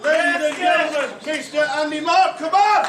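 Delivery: big hyped winner announcement; ring announcer. Acted. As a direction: boom it out like a boxing MC, rising to the name.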